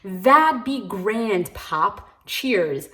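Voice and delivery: slow, drawn-out fake English accent; lightly mocking British voice, stretched out. Reviewer mocks a European character's talk in a slow British accent. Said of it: "talking in this, like, European accent".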